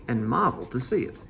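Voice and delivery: ordinary delivery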